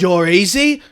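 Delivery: ordinary talk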